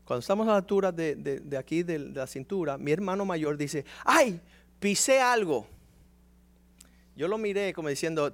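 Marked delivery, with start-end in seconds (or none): 4.0-5.7 higher, louder sudden cry; surprised yelp. Retold or imitated. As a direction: cry out at stepping on something. Brother steps on something.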